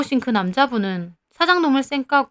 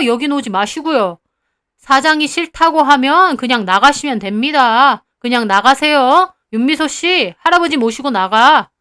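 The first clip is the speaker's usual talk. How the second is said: louder, higher, cold dismissal; haughty owner; 3 semitones higher, 5 dB louder than usual. Acted. Cafe owner ordering the grandfather out, louder and scornful. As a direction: shoo the old man out with contempt.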